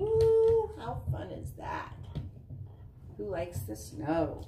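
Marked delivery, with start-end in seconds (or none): 0.0-0.7 high, loud whoop of delight; excited woo. Acted. Mom cheering the sledding dog for the kids, excited whoop. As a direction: cheer the sled ride.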